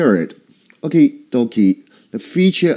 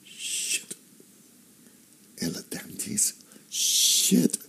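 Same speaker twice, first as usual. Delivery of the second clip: whispered, dreading aside; whispered cursing; 11 dB quieter, slower than usual. Acted. Patient in the dentist's chair, whispered cursing. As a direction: curse under your breath in a whisper.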